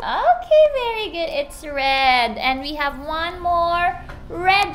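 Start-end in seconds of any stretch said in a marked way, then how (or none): 0.0-1.3 high, loud okay of praise; excited praise. Real, not acted. Praising child's answer.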